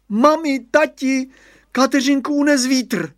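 put-on high, loud boy's cry of alarm. A narrator's reading. Radovan alerts his parents that Kateřinka blew away, crying out. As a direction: call out urgently to mum and dad. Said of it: "vykřikl Radovan".

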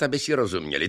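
plain reading voice

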